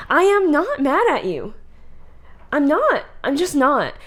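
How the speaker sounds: high, insistent mock denial; mock defensive denial. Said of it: "And then they'll go".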